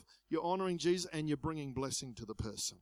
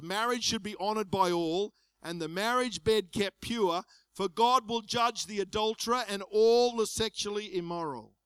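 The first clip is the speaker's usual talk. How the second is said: loud, raised preaching voice; scripture proclamation; 4 semitones higher, 6 dB louder than usual. Acted. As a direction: read the verse out with full force. Reads scripture aloud at force.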